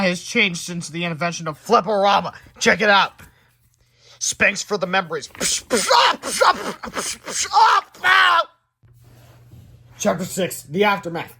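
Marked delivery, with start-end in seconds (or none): 5.3-8.5 spank noises and cries. Acted. Spanking sound effects and cries.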